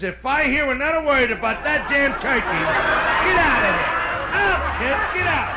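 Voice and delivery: loud, high outburst of anger; angry acted bellow; exasperated priest. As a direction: throw the kid out, shouting. Priest throws the kid out.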